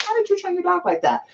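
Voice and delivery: high, airy mimic of curious people; impressed onlookers asking how the dog was trained, voiced high and mocking. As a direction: squeak it out like nosy strangers.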